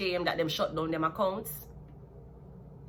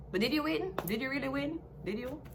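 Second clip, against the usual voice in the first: higher, softer teasing question; sly, softer mock questioning; sarcastic taunt; 6 semitones higher, 6 dB quieter than usual. Real, not acted. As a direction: poke at him, doubting he won.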